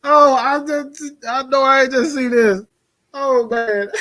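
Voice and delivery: high, cracking up in real amusement; laughing in disbelief. Real, not acted. Seeing what Saints fans are in for, laughing in disbelief. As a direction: talk high through rising laughter. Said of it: "The reason why I'm laughing".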